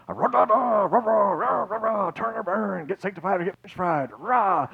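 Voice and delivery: high-pitched mock revival preacher; gospel preacher impersonation. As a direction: rant like a caricature gospel preacher. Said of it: "gospel preaching impersonation guy".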